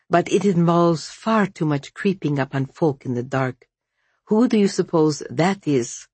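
usual speaking voice

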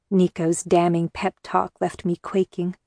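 ordinary delivery

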